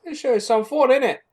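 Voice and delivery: high, hammed-up Cockney echo; over-the-top fake Cockney; mock Cockney. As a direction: parrot the line in an exaggerated Cockney voice. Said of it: "Cockney accent".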